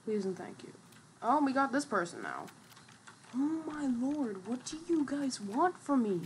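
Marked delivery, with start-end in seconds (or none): 3.3-6.2 exasperated whine. Real, not acted. Whining at attackers.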